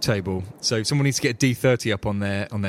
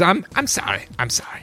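apologetic NPC; 8 semitones higher than usual. Acted. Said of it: "looks at Helga apologetically and says".

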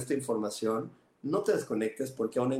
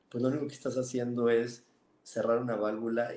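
usual speaking voice

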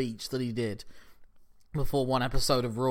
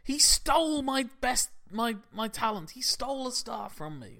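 outraged rant; 9 semitones higher than usual. Retold or imitated. Vince ranting about stolen talent.

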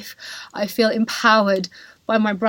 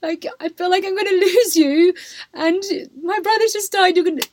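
higher, tearful voice of her sister; imitating tearful sister; 9 semitones higher than usual. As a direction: voice the sister pleading through tears. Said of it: "burst into tears".

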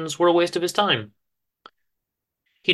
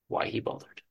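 whispered trail-off; 11 dB quieter than usual. A narrator's reading. Narrator trails off.

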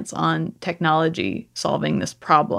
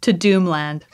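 normal talking voice

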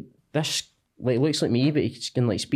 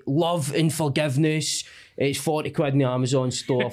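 ordinary talk, nothing marked

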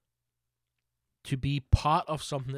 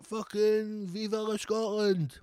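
much higher, slow, drawn-out rallying cry; hammed-up national pride; mock patriotic cheer; 9 semitones higher, slower than usual. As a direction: Scot mocking his own pride over Irn-Bru, high and slow. Mock patriotic pride in Irn-Bru.

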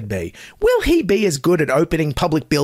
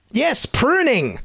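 slow, high mock awe; deadpan sarcasm, stretched and high; sarcastic; 8 semitones higher, much slower than usual. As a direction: drag it out as if it were huge news.

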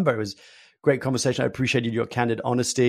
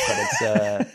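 breaks into a high laugh mid-word; slower than usual. Real, not acted. Guest joking about his French surname, laughing. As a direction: laugh through the words.

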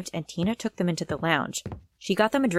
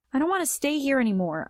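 higher-pitched, upset thought; 7 semitones higher than usual. A narrator's reading. Crying girl packing her things, upset. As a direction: voice lifts in mild distress.

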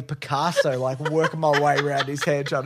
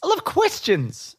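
mock eager; 10 semitones higher, slower than usual. Real, not acted. Sarcastic eager reply.

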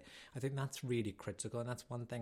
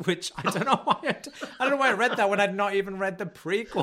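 higher, louder, laughing at herself; laughing confession; 9 semitones higher, 15 dB louder than usual. Real, not acted. Admitting to her co-host, laughing loudly. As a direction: giggle through the admission.

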